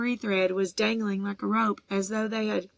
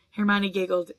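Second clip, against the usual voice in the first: ordinary delivery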